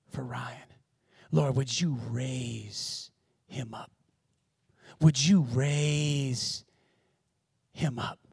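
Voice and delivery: low, soft, slow pleading; hushed retold prayer. Woman praying for Ryan, hushed and imploring. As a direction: pray it softly, drawing out "raise".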